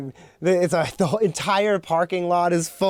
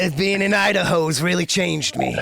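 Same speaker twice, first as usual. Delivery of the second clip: deep rancher voice. Pretend. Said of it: "some new bass in my voice".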